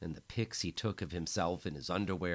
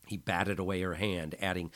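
ordinary delivery

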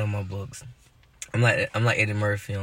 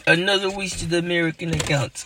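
put-on hammy Russian accent, pitched up; bad Russian accent; 7 semitones higher than usual. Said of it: "Horrible Russian accent".

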